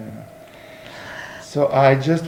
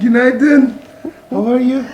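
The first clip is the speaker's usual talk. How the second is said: loud cheerful greeting; 8 semitones higher, 7 dB louder than usual. Real, not acted.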